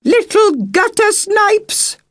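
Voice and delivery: very high, loud, slow shriek; shrieked threat. Acted. Megan shrieks a threat after the fleeing girls, high and loud. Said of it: "shrieked the woman".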